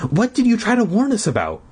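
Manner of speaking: high, fast questioning; high character voice. Acted. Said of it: "Jan asked".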